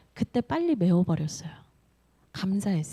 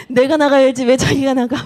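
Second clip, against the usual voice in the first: louder retold grumble at the husband; mock indignation; 3 semitones higher, 9 dB louder than usual. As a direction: replay her fuming protest. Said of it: "부글부글부글부글 했어요". (씩씩거리는 흉내)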